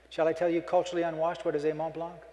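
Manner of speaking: ordinary talk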